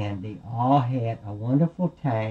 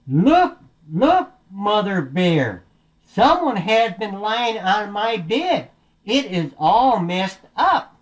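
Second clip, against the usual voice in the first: high, slow, emphatic story-bear call; alarmed Daddy Bear; 7 semitones higher, much slower than usual. Acted. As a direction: announce the mess in slow alarm. Daddy Bear finds his bed disturbed.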